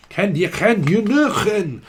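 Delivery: high, loose mock Scottish accent. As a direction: put on an exaggerated Scottish voice. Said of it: "Someone that's from Scotland".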